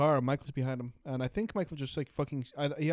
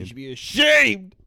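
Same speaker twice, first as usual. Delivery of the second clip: mock scolding; 5 semitones higher, 11 dB louder, slower than usual. Mock scolding after coughing.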